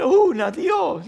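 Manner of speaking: high loud cry for one chance; football player's shout. Retold or imitated. Footballer after a miss shouting at God, high and loud. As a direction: yell it like a striker begging heaven. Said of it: "Si te dice".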